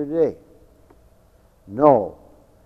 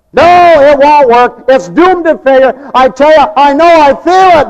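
loud, high, slow sermon cry; full-force preaching; 9 semitones higher, 14 dB louder, slower than usual. Acted. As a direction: thunder it from the pulpit. Preaching, voicing doubters loudly.

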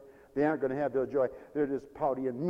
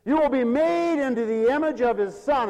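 preaching climax; 7 semitones higher, 8 dB louder than usual. Acted. Sermon climax.

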